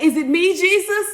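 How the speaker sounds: high, loud, worried plea; anxious disciple. Acted. A disciple asks Jesus if he's the betrayer, rising and loud. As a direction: ask it like you fear the answer.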